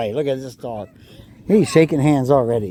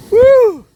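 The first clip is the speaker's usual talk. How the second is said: high loud woo; excited whoop; 11 dB louder than usual. Real, not acted. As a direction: let out a joyful woo. Bouncing on trampoline.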